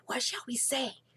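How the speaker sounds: urgent, anxious whisper; feverish whisper. Acted. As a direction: whisper it hurriedly in a panic. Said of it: "whispering feverishly again".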